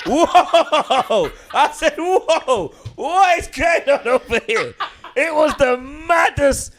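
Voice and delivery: high, slow, stunned exclamation; mock shocked whoa. Telling friends how he reacted to the offer, high and amazed. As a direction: act out being stunned by the scene. Retelling shock in Prospect Park.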